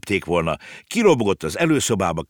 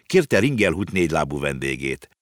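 ordinary delivery, nothing marked

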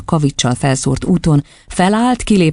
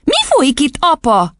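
put-on high child's voice, demanding; high childish questioning; alarmed little girl; 12 semitones higher than usual. A narrator's reading. Girl demands explanation from father.

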